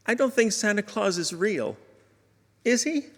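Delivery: lightly voiced boy mustering courage; wavering child. Son confronts his dad about Santa, lightly wavering child voice. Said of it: "with wavering finality said".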